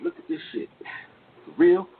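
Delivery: high-pitched, incredulous question; retold disbelief. Retold disbelief at the picture-taking.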